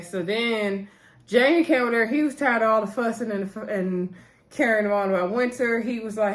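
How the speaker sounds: normal talking voice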